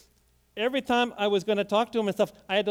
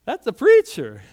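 loud proclamation; 2 semitones higher, 12 dB louder than usual. Retold or imitated. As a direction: repeat it much louder, ringing out. Pastor recognizes a preacher.

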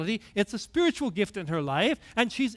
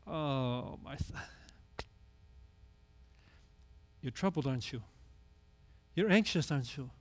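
tender Jesus; 6 semitones lower, 9 dB quieter than usual. Retold or imitated. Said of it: "did you hear the heart of Jesus".

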